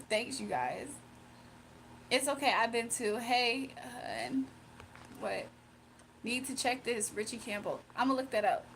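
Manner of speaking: normal talking voice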